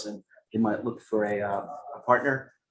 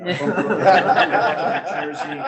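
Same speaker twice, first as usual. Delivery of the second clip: laughing through a joke about his roots, higher; 7 semitones higher, 6 dB louder, slower than usual. Real, not acted. Investor laughing as he tells the panel where he's from, laughing. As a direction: chuckle the words out, drawn out.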